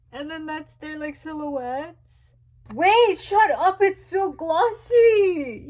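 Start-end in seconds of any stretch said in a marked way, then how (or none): 2.7-5.7 higher and louder, stretched slow with a near-sob; excited squeal. Real, not acted. Fan unboxing merch, squealing at the glossy print. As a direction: gush in disbelief at how shiny it is.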